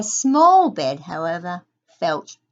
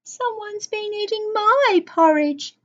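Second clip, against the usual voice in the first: much higher, lady bear voice; Mother Bear's medium voice; 11 semitones higher than usual. A narrator's reading. Mother Bear echoes the complaint about her porridge, high-pitched. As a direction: echo it high, in a she-bear's voice. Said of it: "echoed Mother Bear in a medium voice".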